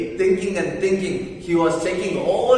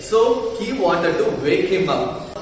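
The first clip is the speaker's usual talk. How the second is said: usual speaking voice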